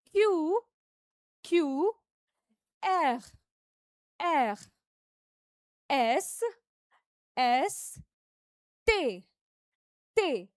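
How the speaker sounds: French letters called out clearly; teacher modeling French letters; French letter names. Shown as a demonstration. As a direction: drill French letters for the class. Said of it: "अब आगे करेंगे".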